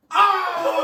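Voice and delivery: high, loud imitated "oh"; shocked group reaction. Cast reacting to exposed costume mishap, imitated shock. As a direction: imitate a whole room's shocked cry. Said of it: "everyone looks at me and they go".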